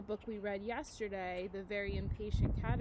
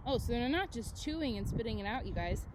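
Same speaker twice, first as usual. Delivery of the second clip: ordinary delivery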